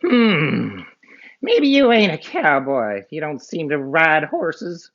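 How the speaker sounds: high, musing cartoon bad guy; outlaw voice. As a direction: size him up suspiciously.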